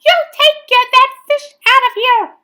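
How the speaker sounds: very high, loud outrage; angry yell. A narrator's reading. Swimmers ordering the boy's fish out, loud yell. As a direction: shout it as an indignant crowd. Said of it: "they yelled".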